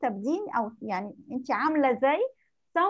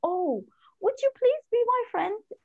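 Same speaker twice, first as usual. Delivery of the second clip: soft quiet voice; 8 semitones higher, much slower than usual. A narrator's reading. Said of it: "She spoke in a soft, quiet voice".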